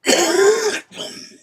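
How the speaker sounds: loud hacking cough; a real coughing fit. Real, not acted. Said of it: "We're coughing".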